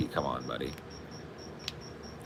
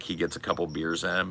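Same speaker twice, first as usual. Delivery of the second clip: ordinary talk, nothing marked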